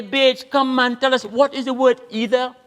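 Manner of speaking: high-pitched, urgent appeal to a passer-by; calling out. Acted. Arguers asking a passing Bajan to judge, calling out. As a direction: hail him high to settle the row.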